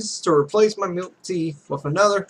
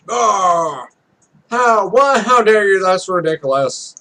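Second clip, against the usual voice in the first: outraged; 2 semitones higher, 5 dB louder than usual. Acted. Voicing the character insulted about Korean players, outraged. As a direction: burst out, offended and shocked. Character offended by foreign fans.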